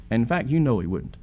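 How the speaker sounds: ordinary talk, nothing marked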